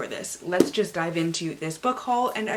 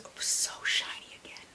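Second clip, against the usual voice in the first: awed whisper; 9 dB quieter than usual. Real, not acted. Awed at a shiny cover.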